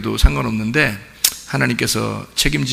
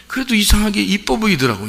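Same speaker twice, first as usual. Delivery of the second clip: excited; 9 semitones higher than usual. Real, not acted. Same point again, much higher.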